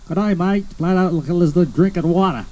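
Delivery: a light Australian twang, playful; Australian accent. Retold or imitated. Speaker imitating how Australians talk, Australian accent. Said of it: "talking like they do".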